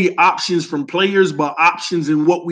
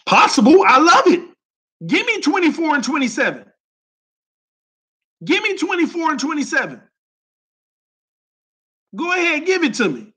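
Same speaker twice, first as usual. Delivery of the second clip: higher, thrilled, almost squealing; excited; 8 semitones higher than usual. Real, not acted. Streamer hyping a Dolphins trade to chat, excitedly higher. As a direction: get giddy over a dream trade.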